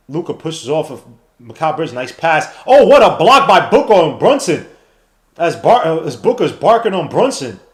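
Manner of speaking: live announcer calling the game; play-by-play commentary. Acted. Calling the Mavericks game for viewers like a broadcaster. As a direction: call each possession like a broadcaster.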